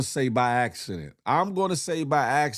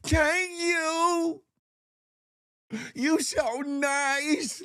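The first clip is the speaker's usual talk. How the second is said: put-on high, slow cute kid voice; sweetly thanking like a small child; little kid voice; much slower than usual. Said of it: "He said".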